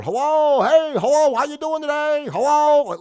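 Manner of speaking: high, loud sing-song calling hello; mock salesman yelling. Imitating salesmen yelling hello into his front door. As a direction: call through the door like a pushy salesman. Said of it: "they were yelling into my door".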